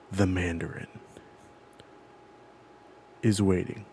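low, hushed delivery with a long suspenseful pause after "Mandarin"; ominous movie line. Retold or imitated. Host setting a film's opening scene for his friend, ominous and hushed. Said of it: "the line that we hear".

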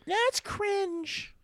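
put-on high, snooty teen voice; mocking teen voice. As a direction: sneer it like a bratty teen. Mocking younger kids' judgment.

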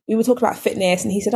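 ordinary delivery

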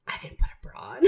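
hushed, breathy whispered confession; alarmed whisper. Retold or imitated. Host quotes her friend realizing at dinner, whispered in alarm. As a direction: whisper it like a panicked secret.

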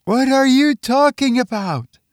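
high-pitched, incredulous woman's voice; baffled wife. Acted. Wife questions her husband's wild claims, baffled and high.

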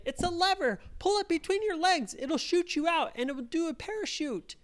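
explaining quickly and excitedly; helpful friends. Acted. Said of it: "they said".

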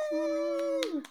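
high excited woo; cheering. Real, not acted. Cheering a Patreon supporter.

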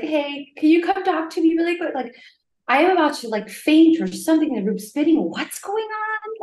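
acted-out alarm, voice lifted high; retold panicked call. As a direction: call the nurse urgently, pitch climbing. Acts out panicked call to nurse.

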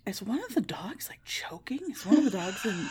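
hushed, anxious whisper; a worried whisper over the sleeping dogs. Retold or imitated. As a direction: whisper like you fear a dog is choking. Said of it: "I'm like".

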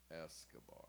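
breathy whispered name reveal. Pretend. Whispering a fake name to friends. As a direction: hush it like a secret alias.

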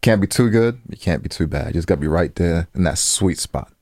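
ordinary talk, nothing marked

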